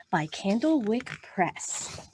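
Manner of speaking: normal talking voice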